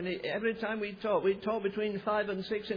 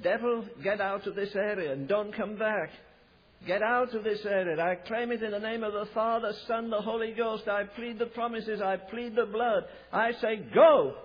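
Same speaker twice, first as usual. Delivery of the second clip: commanding rebuke; 3 dB louder than usual. Retold or imitated. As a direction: cast the devil out, rising to a shout on "go". Preacher rebuking the devil.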